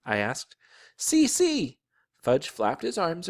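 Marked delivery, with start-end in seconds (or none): 1.0-1.8 put-on very high little-boy voice; piping, eager call for attention; excited toddler. A narrator's reading. Toddler calls brother to watch him.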